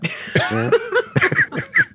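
high, long burst of laughter; big laugh. Real, not acted. Host laughs at his own joke, big laugh. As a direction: crack up at your own joke.

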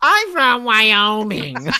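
high, drawn-out fake Wyoming accent. Host joking he is from Wyoming, mock Wyoming accent. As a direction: stretch it out in a silly Wyoming accent. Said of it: "That's my good Wyoming accent".

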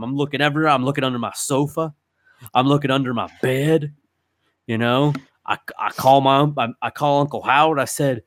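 usual speaking voice

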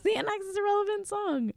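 tiny, very high voice; very high joking repeat. Acted. Host repeating the joke to listeners, very high-pitched. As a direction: push it right up into a squeak.

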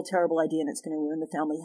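ordinary talk

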